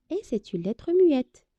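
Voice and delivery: ordinary talk, nothing marked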